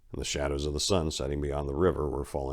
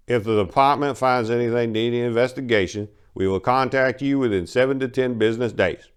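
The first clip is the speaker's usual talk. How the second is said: brisk, bored office clerk, a bit louder; curt clerk voice; 6 semitones higher, 5 dB louder than usual. Acted. Clerk dismissing Maria, curt and bored. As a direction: read it off like a form letter.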